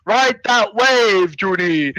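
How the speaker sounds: very slow, stretched-out grandpa voice; grandpa's call. Retold or imitated. Grandpa cheering Judy on the wave, drawn-out call. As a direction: draw out each word as a cheering Grandpa. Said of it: "then Grandpa's like".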